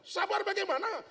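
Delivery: high, loud scolding wife; angry nagging. Acted. Wife angrily nagging husband who came home without groceries. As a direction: rant at him for empty hands. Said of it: "marah besar".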